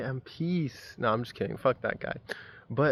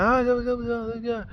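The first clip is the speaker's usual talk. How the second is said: high held "ah" into jabbering noise; mock road-rage blare; imitated honking and raging; 10 semitones higher than usual. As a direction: wail high, then babble as it fades. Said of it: "he's, like, honking now".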